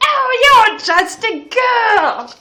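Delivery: high, loud, drawn-out amazement; exclaiming. A narrator's reading. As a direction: exclaim it loudly in hammed-up surprise. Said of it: "they exclaimed".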